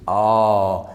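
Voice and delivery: usual speaking voice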